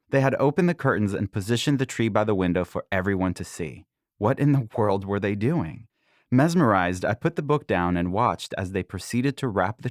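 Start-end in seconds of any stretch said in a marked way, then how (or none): none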